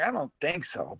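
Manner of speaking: very soft inner doubt; private thought. Retold or imitated. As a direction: drop the volume right down, as if thinking it. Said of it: "in my mind, I'm like".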